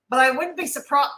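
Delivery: hiccuping mid-sentence; hiccups. Real, not acted. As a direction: talk through a hiccup. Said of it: "I got the hiccups today again".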